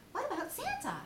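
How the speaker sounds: very high, small Piglet voice; a tiny worried squeak; timid Piglet. Acted. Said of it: "Piglet nudged Pooh".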